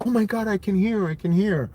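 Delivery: high-pitched imitation of overjoyed astonishment; mock amazement. Mimics miracle cochlear implant videos.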